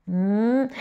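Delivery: usual speaking voice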